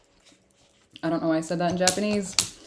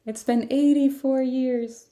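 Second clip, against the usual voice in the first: put-on high, slow little old lady voice; wistful old-lady meme quote, pitched up and drawn out; Wendy; 7 semitones higher than usual. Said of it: "the little old lady from, uh, Hook".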